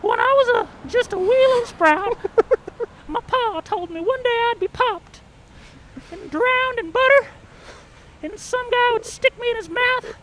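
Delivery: high Southern twang, giddy; a corn cob thrilled to be popped; excited Southern corn. Pretend. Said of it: "same Southern corn, but now it's actually really excited".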